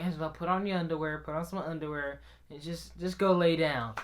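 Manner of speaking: soft, low-key instruction; quiet calming stepmom. Retold or imitated. Stepmom sending crying boy to bed, soft calming voice. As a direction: talk the upset kid down softly.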